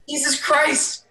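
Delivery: high exasperated outburst. Acted. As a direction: throw up your hands at her.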